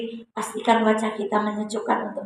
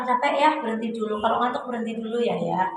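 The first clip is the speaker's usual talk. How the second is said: tender caring wife; 2 semitones lower, 6 dB quieter than usual. Acted. Wife caring for driving husband.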